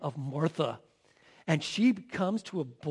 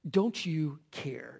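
soft, slow don't you care; self-pitying question; 4 semitones lower, 4 dB quieter, slower than usual. Acted. Martha pitying herself before Jesus, soft and slow. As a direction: let it sink low in self-pity. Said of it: "All the serving alone".

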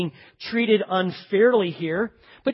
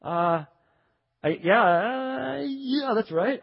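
long, hesitant drawn-out concession; stall, then give in sheepishly; reluctant admission; much slower than usual. Acted. Worker admitting the agreed wage, reluctant.

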